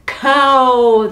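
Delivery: a loud greedy bellow; giants bellowing. Acted. Hungry giants reaching for the cow, bellowing. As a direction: roar it out, hungry and impatient. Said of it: "they bellowed".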